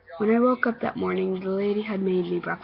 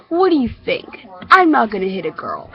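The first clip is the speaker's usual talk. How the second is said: taunting bully; 7 dB louder than usual. Acted. Bully threatens to have a girl hit the kid, loud taunting. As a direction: threaten with loud mocking confidence.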